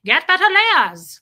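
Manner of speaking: put-on loud, high German-accented yell; mock German shout, stretched and scary. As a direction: bellow it slowly in a German accent. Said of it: "just yelling at people in her German accent".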